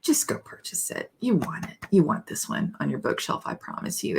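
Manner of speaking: hushed, fast, conspiratorial whisper; whispered urging. Real, not acted. Minister whispering to her congregation that they should buy the book. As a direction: whisper it like a tip between friends.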